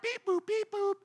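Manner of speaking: high mechanical beeps; robot beeps. Pretend. God mocked as programming people, robot beeps. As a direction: beep like a toy robot.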